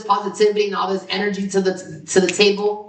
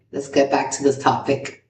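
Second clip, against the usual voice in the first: normal talking voice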